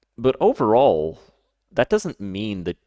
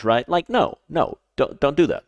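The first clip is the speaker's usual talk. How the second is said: ordinary delivery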